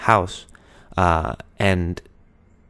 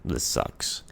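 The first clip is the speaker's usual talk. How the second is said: deflated whisper; 6 semitones lower, 8 dB quieter than usual. Retold or imitated. Deflated after honeymoon ends.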